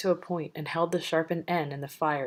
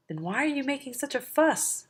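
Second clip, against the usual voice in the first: much higher, exasperated giant voice; shouted angrily; 10 semitones higher than usual. Acted. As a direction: snap at him, fed up. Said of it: "the others shouted angrily".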